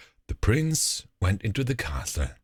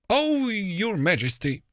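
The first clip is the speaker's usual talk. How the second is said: dismayed cook; 12 semitones higher than usual. A narrator's reading. Horrified cook answers ogress queen.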